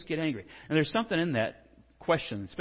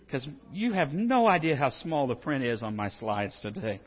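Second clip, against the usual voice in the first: normal talking voice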